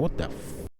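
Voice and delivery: whispered shocked curse. Retold or imitated. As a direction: breathe out the curse in disbelief. Shocked aside.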